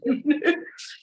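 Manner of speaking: high, bursting self-conscious laugh; a real laugh at her own stubbornness; rueful laugh. Real, not acted. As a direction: laugh at yourself, caught out.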